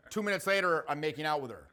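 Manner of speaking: normal talking voice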